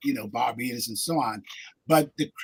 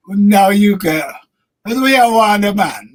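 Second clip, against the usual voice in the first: put-on light Jamaican accent; a hint of a Jamaican lilt; 7 semitones higher, 12 dB louder than usual. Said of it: "your Guyanese Jamaican accent sound like?".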